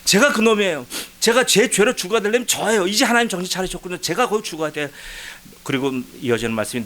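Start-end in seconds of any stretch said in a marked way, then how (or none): 0.0-4.9 high, eager cry to God; retold joyful agreement. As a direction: agree gladly, pitched well above normal talk. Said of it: "얼마나 기쁜지". (기뻐서 높은 목소리로 맞장구치는 기도)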